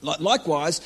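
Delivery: ordinary talk, nothing marked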